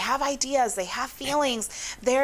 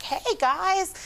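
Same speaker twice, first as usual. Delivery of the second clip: bright, high "Hey guys" to the class; cheery greeting; 9 semitones higher than usual. Shown as a demonstration. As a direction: greet the kids with a lift of energy. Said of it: "or if I'm like".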